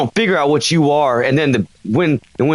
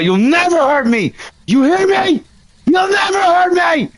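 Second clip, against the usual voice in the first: defiant shout; 11 semitones higher than usual. Real, not acted. Ghost hunter daring the ghost to hurt him, defiant shouting. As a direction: challenge it, hurl the words out.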